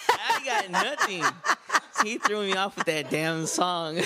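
very high, slow words squeezed through laughter; laughing hard. Real, not acted. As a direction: barely get it out while cracking up. Still laughing after song prank.

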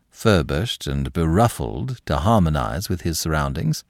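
ordinary delivery

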